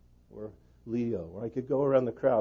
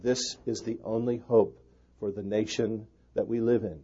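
ordinary delivery

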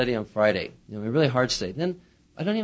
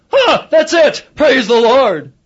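much higher, loud joyful cry; exultant praise shout; eureka shout; 10 semitones higher, 11 dB louder, much slower than usual. Retold or imitated. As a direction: shout it like a sudden breakthrough. Sudden illumination.

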